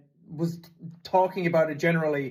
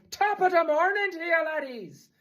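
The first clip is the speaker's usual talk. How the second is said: exaggerated stage-Irish call; mock Irish accent; 11 semitones higher than usual. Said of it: "[name] pretends to be Irish".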